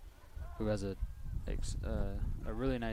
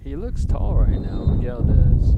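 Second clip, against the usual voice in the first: excited call; 15 dB louder, slower than usual. Real, not acted. Commentator reacts live to player.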